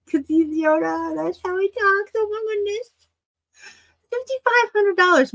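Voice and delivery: high, giddy squeal; squealing excitement. Real, not acted. Collector reacting to the Yoda price, high giddy squeal. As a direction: gush up high with delight.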